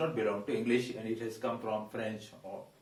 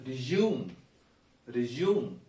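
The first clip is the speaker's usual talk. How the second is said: 'resume' as 'ri-JOOM'; second-syllable stress; slower than usual. Shown as a demonstration. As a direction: lean on 'JOOM' in 'resume'. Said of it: "The second syllable is stressed".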